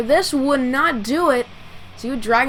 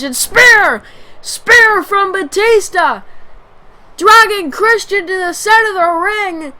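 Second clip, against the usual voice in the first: loud, high, excited play-by-play call; wrestling commentator; 8 semitones higher, 9 dB louder, slower than usual. Acted. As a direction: call the big move at full pitch.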